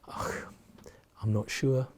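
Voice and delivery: hushed, hesitant "Oh", then a tentative reply; a half-whispered, uncertain answer; unsure murmur. Retold or imitated. Retelling his unsure answer to a colleague, hushed and hesitant.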